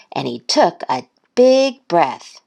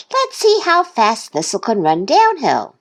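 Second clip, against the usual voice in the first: higher, eager urging; yelled; 6 semitones higher than usual. A narrator's reading. Duck hurrying Goose to watch Thistle, eager yell. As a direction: urge on in a raised pitch. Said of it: "Duck yelled".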